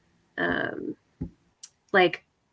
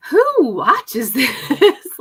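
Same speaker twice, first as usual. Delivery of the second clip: higher, louder, drawn-out appalled question; disturbed disbelief; 6 semitones higher, 8 dB louder, much slower than usual. Retold or imitated. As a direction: retell your horrified disbelief, stretching it out with a laugh. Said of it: "I was so disturbed".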